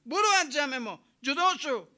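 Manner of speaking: loud, high, quick shouted order; retold cry. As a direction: call out as a prophet ordering someone out of the crowd. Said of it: "موسی کلیم الله فریاد زد". (نقل فریاد موسی با صدای بلند و زیر)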